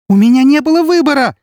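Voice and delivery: shrieked, frantic self-defence; desperate scream. A narrator's reading. She screams in despair.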